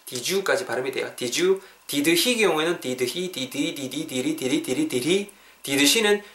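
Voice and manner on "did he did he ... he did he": "did he" repeated until the sounds blend; "did he" running together as one word; linked "did he". Shown as a demonstration. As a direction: drill "did he" into a run-together "diri". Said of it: "이렇게 음이 연결되거든요".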